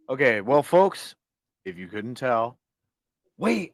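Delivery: ordinary talk, nothing marked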